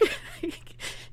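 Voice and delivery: sudden high broken sob; a sob breaks through the words; real crying sob. Real, not acted. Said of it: "I'm gonna get emotional".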